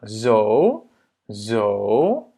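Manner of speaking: zo slowly, O held long. Shown as a demonstration. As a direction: stretch the O in zo. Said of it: "c'est un O long". (« zo » avec un O long)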